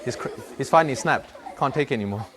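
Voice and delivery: ordinary talk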